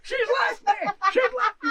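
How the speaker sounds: high, loud wailing scream of a jilted man; anguished scream. Acted. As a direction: scream it out like you've just been dumped. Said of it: "people screaming from England".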